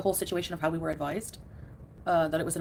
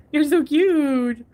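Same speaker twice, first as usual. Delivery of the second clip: very slow, high, louder doting coo; doting on cat; 7 semitones higher, 9 dB louder, much slower than usual. Real, not acted. Owner telling her cat it's cute, slow high coo. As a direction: stretch each word out adoringly.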